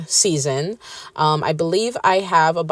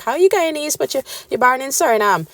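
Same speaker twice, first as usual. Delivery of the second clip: high mock-puzzled voice; confused people; 8 semitones higher, faster than usual. Mocking people confused by her Guyanese roots, high puzzled voice. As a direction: mimic a baffled questioner, pitched way up. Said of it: "They're like".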